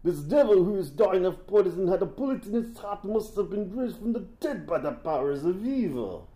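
high-pitched mock-Russian voice; hammy Russian accent. Podcaster quoting the assassin on the undying devil, hammy Russian accent. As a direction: declaim it in an exaggerated Russian accent.

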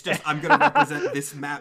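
laughing while explaining; words pushed out through laughter. Real, not acted.

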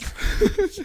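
loud, breathy laugh; burst of laughter. Real, not acted. Co-host teased about six months off, laughing loudly.

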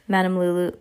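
usual speaking voice

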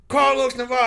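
high, loud name call; matter-of-fact projected roster call; coach's roll call. Retold or imitated. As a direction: call the name out across the gym. Coach calling his name.

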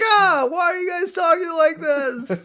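high, far-off voice in a mock panic; Homer freaking out. Homer baffled by his family's accents, high panic. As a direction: squawk it in hammed-up alarm. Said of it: "Homer freaks out".

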